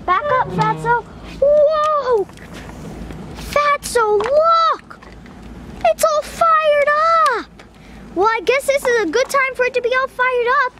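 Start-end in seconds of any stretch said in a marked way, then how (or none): 1.4-7.5 thrilled by the roaring fire; excited wow. Real, not acted. Vlogger showing his dog the blazing stove, high excited voice.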